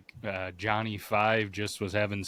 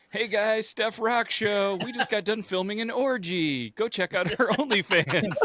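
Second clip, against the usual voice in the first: mock intro voice; 11 semitones higher, slower than usual. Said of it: "the first thing was like".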